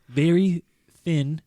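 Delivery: ordinary talk, nothing marked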